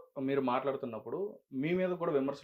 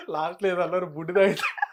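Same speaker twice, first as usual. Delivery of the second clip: laughing; 6 semitones higher, 9 dB louder than usual. Real, not acted. Interviewer repeats word while laughing.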